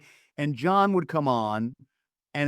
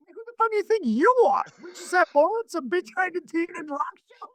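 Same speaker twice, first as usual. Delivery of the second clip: much higher, strained mock snap-back; imagined retort. Promo man imagines snapping back at the singer, high mock outrage. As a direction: fire the insult right back, hammed up. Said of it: "what you were gonna say was what".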